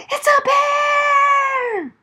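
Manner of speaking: high, slow, loud scream of alarm; shrieking bear alarm; frightened shout. A narrator's reading. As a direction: yell the reveal. The bear revealed.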